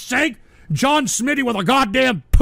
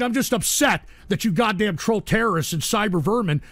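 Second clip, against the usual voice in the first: usual speaking voice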